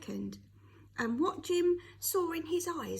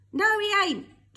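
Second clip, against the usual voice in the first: high, very loud defiant shout; 8 semitones higher, 14 dB louder than usual. A narrator's reading. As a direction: hurl it back at the preacher. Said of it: "Jim shouted".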